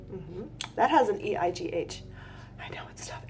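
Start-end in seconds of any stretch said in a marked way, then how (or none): 2.6-3.2 soft unvoiced whisper. Real, not acted. Teacher confiding to the viewers, whispering. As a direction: whisper it like a secret aside.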